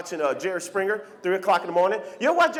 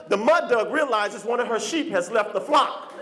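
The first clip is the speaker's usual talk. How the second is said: normal talking voice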